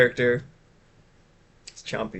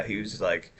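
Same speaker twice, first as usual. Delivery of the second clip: normal talking voice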